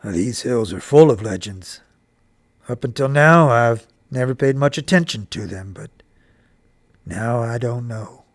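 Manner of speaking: serious father. A narrator's reading. Papa pondering the legend of the red fern, serious. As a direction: speak low and measured, as the serious father. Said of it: "With a serious look on his face".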